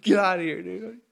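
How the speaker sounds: loud, high scared yell; startled yell. Retold or imitated. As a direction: yell it like something's coming at you. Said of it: "I was".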